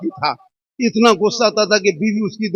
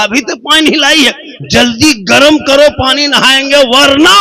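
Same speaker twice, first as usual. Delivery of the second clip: higher, commanding husband barking for hot water; bossy husband; 7 semitones higher, 3 dB louder than usual. Acted. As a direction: order her about like a stern husband. Husband bossing wife again. (पति का पत्नी पर रौब से हुक्म)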